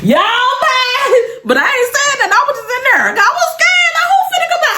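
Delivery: high-pitched, laughing through the words; laughing hard. Real, not acted. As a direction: crack up while you talk. Laughing while telling the story.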